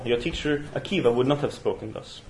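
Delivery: usual speaking voice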